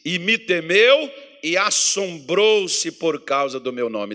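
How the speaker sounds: loud, slow pulpit reading; solemn scripture proclamation. Acted. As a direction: proclaim the verse to the church. Lê Malaquias em voz de púlpito. (leitura solene, alta e lenta)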